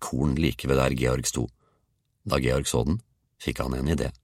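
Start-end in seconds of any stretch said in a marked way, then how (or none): none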